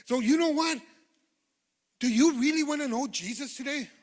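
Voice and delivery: loud, fervent preacher's call; loud pulpit proclaiming of miracles; full-force preaching. Acted. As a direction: preach it to the congregation, building fire.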